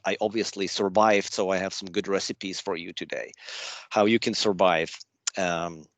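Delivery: ordinary talk, nothing marked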